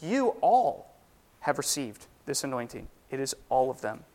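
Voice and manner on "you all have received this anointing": emphatic You all, rest falls away; stress on you all. Shown as a demonstration. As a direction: hit You all, meaning every one of them. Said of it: "but he is saying".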